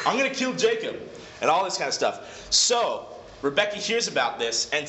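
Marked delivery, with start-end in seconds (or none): 0.1-1.0 high, acted outburst of vengeful fury; freaking out. Robbed brother threatens to kill his twin, freaking out. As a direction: rant it high, imitating a furious brother. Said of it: "he's just freaking out".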